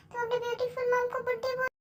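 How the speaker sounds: mock offended. Mom mock-offended at being called old.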